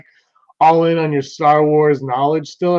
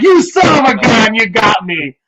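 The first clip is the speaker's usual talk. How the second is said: mock outrage; 7 semitones higher, 5 dB louder than usual. Retelling being tricked by article.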